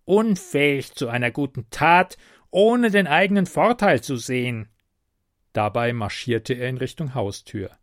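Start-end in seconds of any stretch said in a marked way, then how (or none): 0.0-4.7 higher, louder goblin grumble; grumbling goblin. A narrator's reading. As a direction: scold like a sniffy goblin. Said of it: "murrte er".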